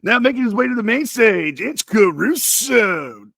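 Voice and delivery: overhyped club announcer, stretching out the name. Acted. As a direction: introduce the next act with swooping DJ hype. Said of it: "how did you like D J N C R".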